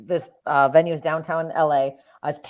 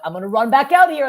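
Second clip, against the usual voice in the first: raised, louder call to crowd; retold stage announcement; 7 semitones higher, 8 dB louder than usual. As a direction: hype up the redo for an audience.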